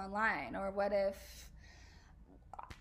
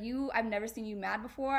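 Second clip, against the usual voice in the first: ordinary talk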